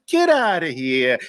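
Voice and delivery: a touch of Brooklyn on "Get outta here". Shown as a demonstration. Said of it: "That's how we used to say it in Brooklyn".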